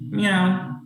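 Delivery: a simple cat meow. Acted. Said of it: "Looks over to Rhea and goes".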